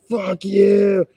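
a high, loud mocking curse; mock yell. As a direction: spit it out like a fleeing tough guy. Retold people cursing and running.

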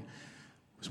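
slow breathy whisper of the words. Real, not acted. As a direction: hush it like a confession. Whispered testimony.

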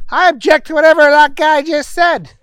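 high-pitched, forceful objection; objecting Booger. A narrator's reading. Booger objecting to the other lawyer, high and emphatic.

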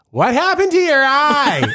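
put-on high, loud kid blurting a question. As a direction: shout it out like a tactless child. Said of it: "one of the kids goes".

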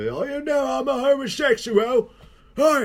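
very high, loud put-on British gangster; hammed-up psychopathic gangster voice; Tom Hardy character voice. Unhinged gangster twin in a film, hammed-up voice. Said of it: "he stands like Bane".

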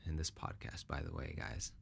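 soft unvoiced aside to listeners; whispered aside. Real, not acted. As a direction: whisper it off-mic. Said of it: "Let me, let me take a sip of water".

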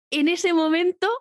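normal talking voice

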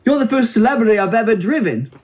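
lightly cockney limo driver; a hint of London cabbie; cockney accent. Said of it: "which I'll give a little more of a cockney".

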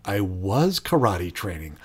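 softly acted realization, slow and low; dawning realization. Trainee realizing what the chores were for, quiet dawning realization. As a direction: let it sink in slowly, quietly. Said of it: "when he makes the realization".

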